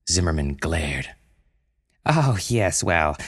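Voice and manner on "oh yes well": stammering. Acted. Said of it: "Brenda stammered".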